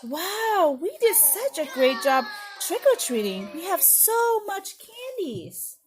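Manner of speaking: animated, sing-song read-aloud with high swoops; children's story narration. Acted. Teacher reads a Halloween book to preschoolers, animated storytelling. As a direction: read it to little ones, full of wonder.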